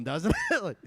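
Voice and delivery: ordinary talk, nothing marked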